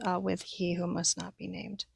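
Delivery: ordinary delivery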